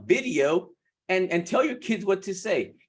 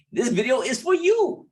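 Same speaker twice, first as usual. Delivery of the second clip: excited; 10 semitones higher, 3 dB louder than usual. Real, not acted. Upbeat intro to viewers.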